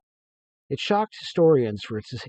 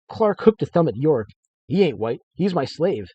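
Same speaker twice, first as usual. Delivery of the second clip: lively read-aloud narration, a bit louder; story narration; 3 semitones higher, 8 dB louder than usual. A narrator's reading. Author reading his story to listeners, lively narration. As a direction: read the tale with energy.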